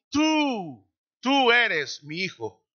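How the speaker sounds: tú lifted high then slow; solemn slow declaration. Acted. Father speaking from heaven at baptism, solemn. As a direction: call out to the son, then declare.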